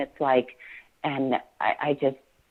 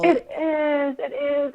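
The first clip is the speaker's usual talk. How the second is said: real crying, voice high and cracking; breaking into tears; 12 semitones higher, slower than usual. Real, not acted. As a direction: apologize through tears as the sobs come. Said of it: "it makes me so emotional".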